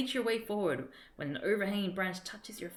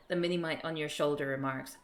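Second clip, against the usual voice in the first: ordinary delivery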